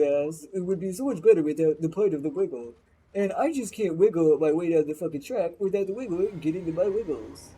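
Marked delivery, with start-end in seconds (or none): none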